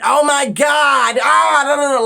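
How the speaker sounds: high, loud dismayed outburst; exclaiming. Real, not acted. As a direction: cry out in dismay. Reacting with dismay.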